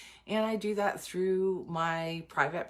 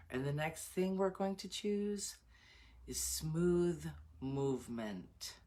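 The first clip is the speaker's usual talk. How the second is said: guided visualization; 2 semitones lower, 4 dB quieter than usual. Acted. Coach leads group through enchanted forest visualization, soft guided meditation.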